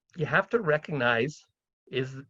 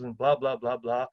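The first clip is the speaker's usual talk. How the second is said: ordinary talk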